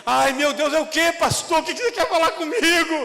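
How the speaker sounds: loud, high, laughing panic; mock nervous. Sister panicking over the pastor's summons, loud and laughing. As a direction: play the scared sister, hammed-up. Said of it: "ela fica com medo de ir".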